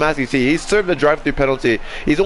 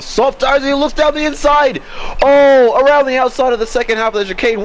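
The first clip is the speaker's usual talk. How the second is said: rising, louder excited call; excited commentary; 8 semitones higher, 3 dB louder than usual. Real, not acted. Excited commentary, overtaking move.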